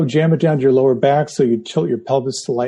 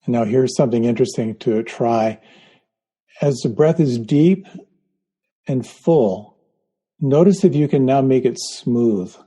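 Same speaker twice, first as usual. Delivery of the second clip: guided meditation. Acted. Leads a guided breathing meditation.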